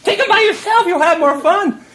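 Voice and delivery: needling her over and over, loud and high; mocking taunt. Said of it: "So I kept laughing and saying".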